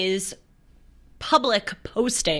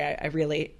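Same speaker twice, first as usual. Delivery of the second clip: ordinary talk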